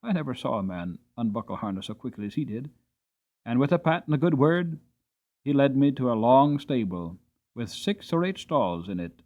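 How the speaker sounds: plain reading voice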